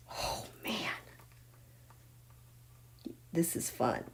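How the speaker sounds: very soft, spent delight; hushed private aside; awed whisper. Real, not acted. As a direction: whisper it under your breath, amazed. Awed aside after the outburst.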